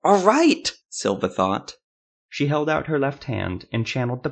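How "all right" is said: much higher, loud angry yell; shout. Acted. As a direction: burst out, finally giving in. Silva gives in, shouting.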